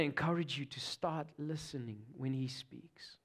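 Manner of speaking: intimate whispered encouragement; whispered heartfelt appeal. Real, not acted. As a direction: lean in and whisper it to them. Earnest appeal to listeners.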